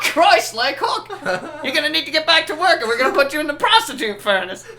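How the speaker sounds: high, loud, fast laughing rant; yelling boss. Acted. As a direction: rattle off threats, cracking up.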